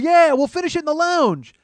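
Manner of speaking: high, loud crowd calling out; crowd shout. Retold or imitated. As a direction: chant it back as the audience. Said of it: "And they go".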